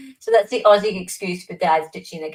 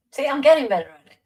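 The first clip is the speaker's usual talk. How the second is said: quick, higher American accent, pleased with himself; American accent again; 6 semitones higher, 5 dB louder, faster than usual. Shown as a demonstration. Still showing off the American accent, fast and higher. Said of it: "How's that?".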